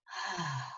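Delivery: soft releasing sigh out; relaxed sigh. Shown as a demonstration. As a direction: let the body sigh out softly. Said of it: "when my body goes".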